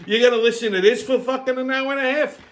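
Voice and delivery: fast, laughing through the words. Real, not acted. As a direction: crack up at your own joke. Laughs at his own bit.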